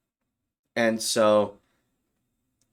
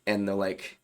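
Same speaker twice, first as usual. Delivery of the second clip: normal talking voice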